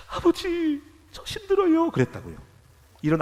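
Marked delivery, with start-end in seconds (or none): 0.1-1.9 high, pleading cry to his father; tearful plea. Retold or imitated. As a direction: plead upward as if crying. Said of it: "울면서".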